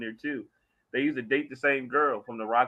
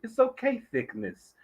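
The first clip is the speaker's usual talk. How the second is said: higher-pitched mock singer talking to a lady; imitated singer's sweet-talk; 11 semitones higher than usual. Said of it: "when he was talking to the lady".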